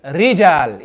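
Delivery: "rijal" with a light tongue shiver; just a touch of trill on "rijal"; slight trill. Shown as a demonstration. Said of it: "just slightly".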